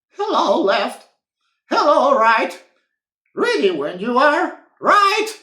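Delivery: high, loud squeaky boot voices; two boots chattering brightly, high and loud; chirpy boots. Acted. Boots greeting each other, high loud chirpy voices.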